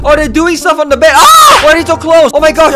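ordinary talk, nothing marked

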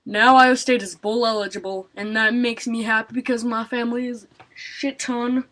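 ordinary delivery

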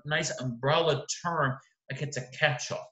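usual speaking voice

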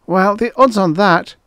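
high, loud dismissal; scoffing. A narrator's reading. Assistant brushes off his boss's worry, scoffing. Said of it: "Frick scoffed".

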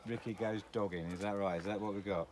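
usual speaking voice

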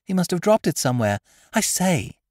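put-on eager little girl. A narrator's reading. Girl handing over the cigarette case, fast, high and loud. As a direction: rattle off the errand. Child voice.

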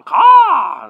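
loud raven caw. Shown as a demonstration. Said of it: "sorry if that was too loud".

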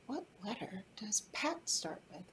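soft hushed question; whispering. Real, not acted.

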